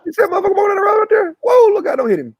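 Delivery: imitating country buddies, very high and fast. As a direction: rattle it off high and quick, swallowing word endings. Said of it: "the last syllable of every word you just kinda skip".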